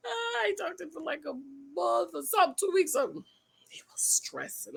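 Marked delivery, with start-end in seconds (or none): 3.7-4.8 breathy gossip whisper. Real, not acted. Vlogger whispering gossip to viewers, whisper. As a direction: lean in and whisper it.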